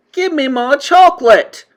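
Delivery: high, loud bratty shout; demanding boy. A narrator's reading. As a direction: insist like a spoiled child. Boy demands his chocolate.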